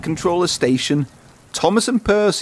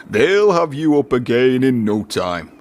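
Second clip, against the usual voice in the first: Fat Controller. Acted. Fat Controller voiced in story.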